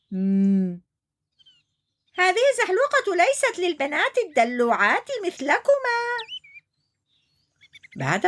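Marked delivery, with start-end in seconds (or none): 0.1-6.0 high, teasing hippo; mocking shout. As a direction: tease the girls rudely. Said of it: "ثم صرخ". (عنتر يسخر من البنات)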